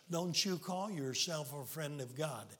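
ordinary talk, nothing marked

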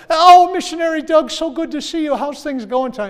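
chirpy, upbeat host voice; overly cheerful high greeting; cheery translator. Retold or imitated.

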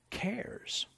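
breathy hush on the key word; whispered. Real, not acted. Preacher to congregation, whispering cares. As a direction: drop to a whisper for emphasis.

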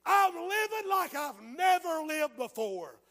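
high, loud, slow quoted shout; belting out the dead man's message; a man's last taped words, shouted high and loud; quoting the taped shout. Retold or imitated. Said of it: "tape of him standing up and said".

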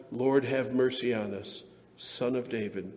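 ordinary delivery

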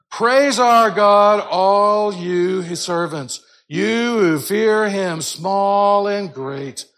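loud, very slow praise; a voice from the throne, called out loud and slow; crying out. Shown as a demonstration. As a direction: proclaim each phrase from the throne. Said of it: "A little, little more crying out".

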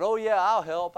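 a hint of a guilty boy rushing to agree; nervous boy. The boy hurrying to please his sister, lightly voiced. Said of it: "So he said".

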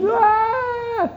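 high imitated baby shriek; imitated baby scream. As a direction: mimic a sudden baby cry. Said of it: "he let out this scream".

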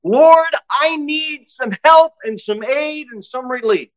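a soldier shouting for aid in battle; warrior calling out. Acted. Preacher voicing Paul as a warrior pleading with the Lord. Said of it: "he calls out as a warrior for Christ".

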